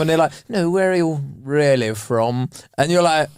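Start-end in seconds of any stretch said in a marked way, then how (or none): 0.5-2.5 put-on slow, probing posh accent. Posh stranger presses you on where you are really from, slow posh accent. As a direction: draw it out in a middle-class posh voice. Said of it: "in an accent going".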